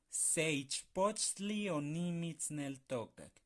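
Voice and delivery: "Seichpochtli onimitzneltokak" muy despacio; lento y claro. Shown as a demonstration. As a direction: articula cada sílaba con calma. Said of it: "otra palabra complicada". (verso lento y marcado)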